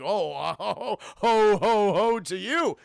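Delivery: slow, loud, suggestive ho-ho-ho; lecherous Santa. Retold or imitated. Santa meeting someone he fancies, hammed-up horny ho-ho. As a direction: drag out each ho with a leer. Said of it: "He is so horny".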